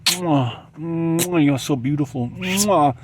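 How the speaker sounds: the sun showers her with loud kisses and purrs; the sun kissing her. Pretend. Sun croons over the woman by the pool, loud and kissy. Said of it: "The sun is like".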